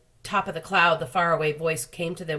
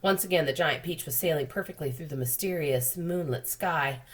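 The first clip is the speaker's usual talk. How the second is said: plain reading voice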